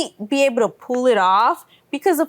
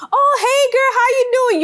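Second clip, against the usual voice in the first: very high, louder sugary greeting; sweet formal greeting; 12 semitones higher, 4 dB louder, faster than usual. Retold or imitated. Greeting a friend the polite way, in a high sugary voice. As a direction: gush it out in a high sing-song. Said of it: "it's not even a".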